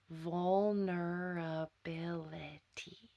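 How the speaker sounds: "Vulnerability" whispered, drawn out slowly syllable by syllable. Shown as a demonstration. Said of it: "I'll say it a little slower".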